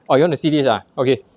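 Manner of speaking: usual speaking voice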